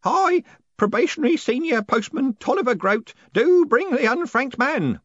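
high, loud, nervous ceremonial announcement; old postman proclaiming high and nervously; shaking voice. A narrator's reading. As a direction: declare it formally with nerves showing. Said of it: "his voice actually shook".

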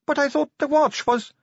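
very high-pitched, puzzled protest; high naive voice, left hanging; protesting recruit. Acted. New recruit objecting to sergeant, high protesting voice.

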